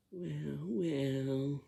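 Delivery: loud, drawn-out mock "well, well"; exaggerated, swelling silly voice; silly way of talking. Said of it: "We don't sound like that".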